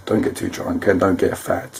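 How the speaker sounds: ordinary delivery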